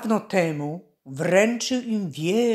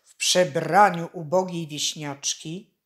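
usual speaking voice

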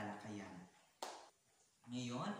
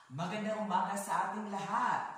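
loud, high class greeting; big, projected good-morning call; 8 semitones higher, 9 dB louder than usual. Real, not acted. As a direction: greet the whole classroom at full voice. Teacher greets the whole class.

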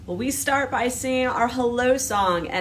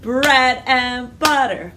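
ordinary delivery

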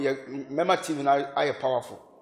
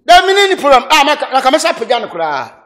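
high, loud, excited exclamation; excited outburst; 8 semitones higher, 10 dB louder than usual. Real, not acted. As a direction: burst out laughing and loud. Excited outburst about the problem.

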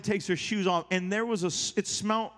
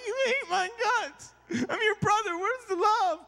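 very high, hammed-up whine; mock upset; 12 semitones higher than usual. Preacher confronting the pastor who squints at him, mock upset. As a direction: plead like a wounded little brother, overdone. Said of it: "I'm over here all upset".

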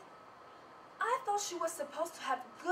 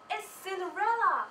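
higher, louder gasp of disbelief; shocked realization; 5 semitones higher, 7 dB louder than usual. Acted. Narrator spotting Cinderella, loud and shocked. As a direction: blurt it out in shock.

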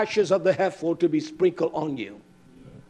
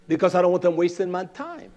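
ordinary talk

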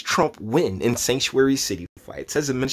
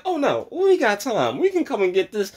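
mock relaxed feds; 11 semitones higher than usual. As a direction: play the feds, taking their sweet time. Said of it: "The feds is like".

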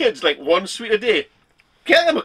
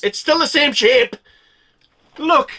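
excited; 5 semitones higher, 7 dB louder than usual. Real, not acted. Showing unchanged chewed candy.

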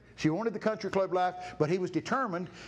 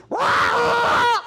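loud high squawk; parrot squawk; 12 dB louder than usual. Retold or imitated. Preacher imitating the parrot squawking from the next room. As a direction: let out a huge bird screech. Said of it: "I hear something in the next room go".

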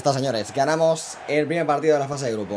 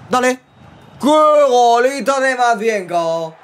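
much higher, louder goal shout; goal celebration; 12 semitones higher, 6 dB louder, much slower than usual. Real, not acted. Goal scored. (celebración de gol)

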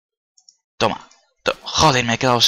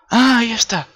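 sudden much higher, louder exclamation; startled cry of discovery; surprised; 8 dB louder than usual. Real, not acted. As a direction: blurt it out on spotting the cat. Spots the cat suddenly.

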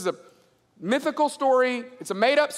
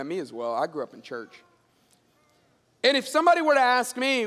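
normal talking voice

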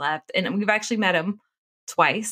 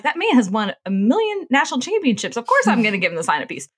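high, loud incredulous protest; indignant retort; 7 semitones higher, 7 dB louder than usual. Retold or imitated. Wife retorts loudly to husband about the famous coach. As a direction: scoff at the silly question.

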